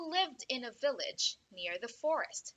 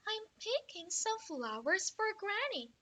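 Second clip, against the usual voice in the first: put-on little girl voice; 9 semitones higher than usual. Girl answers woodcutter.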